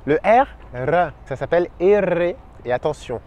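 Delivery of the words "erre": "R" said erre, tongue tapping the palate; R roulé. Shown as a demonstration. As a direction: trill the r in "R", Spanish letter name. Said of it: "la langue vient taper le palais". (« R » dit « erre », r roulé de la langue)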